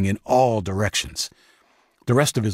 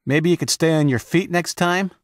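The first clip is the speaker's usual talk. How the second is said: knowing tease; 10 semitones higher than usual. A narrator's reading. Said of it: "with a knowing smile".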